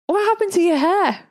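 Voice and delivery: high-pitched shocked question; staring at his ruined hair, disbelieving. Retold or imitated. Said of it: "I was in such shock".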